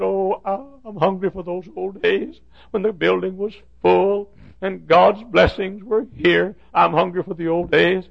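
high, plaintive lament; imitated tearful longing. Custodian hungry for the old days, acted tearful longing. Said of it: "then he began to cry".

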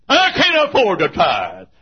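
high, slow, wailed objection; loud protest. Acted. Believer objecting to tithing, loud protest. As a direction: cry out the excuse, drawn out.

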